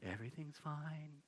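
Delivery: hushed soothing hint of breath; very quiet, calm. Shown as a demonstration. Angel calming Mary, very quiet and calm. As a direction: say it softly, calming a frightened girl. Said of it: "Very quiet, very calm".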